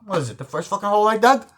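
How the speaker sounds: fast, high, loud comeback; defiant retort. Retold or imitated. Gangster told to dig the grave, indignant retort. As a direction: fire it back indignantly, pitch climbing.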